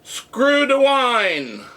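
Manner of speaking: high drawn-out rallying call; long, high crowd-rousing call; retold call to the room. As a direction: holler it slowly to a whole room. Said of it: "I turned to the people in the room and I said".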